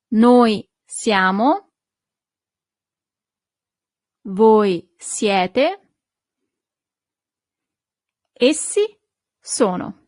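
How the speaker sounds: slow, clear forms with long pauses for the class to echo; model for repetition. Shown as a demonstration. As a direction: say each form slowly so students can repeat. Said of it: "please repeat right after me".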